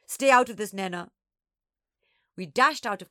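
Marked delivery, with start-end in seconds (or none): 0.1-1.1 loud, sharp telling-off; raised-voice irritated order; annoyed older sister. A narrator's reading. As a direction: shut a little sister out. Older sister scolds the younger.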